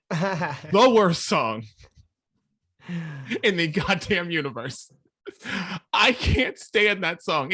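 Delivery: slow, loud, drawn-out condemnation; emphatic disgust. Real, not acted. Host naming his most hated song to co-hosts, emphatic disgust. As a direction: lean hard on each word, exasperated. Rants about worst song pick.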